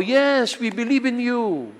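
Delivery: high, bright chorus of the crowd; lightly voiced believing crowd; eager crowd. Crowd answers the wire artist.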